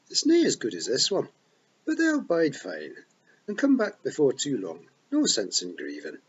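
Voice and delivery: bright, cheery wee pixie voice in a Scots burr; chirpy Feagle chief, happy as you like; cheerful Rob Anybody. A narrator's reading. Rob Anybody cheerfully tells Tiffany the dead come back, cheery.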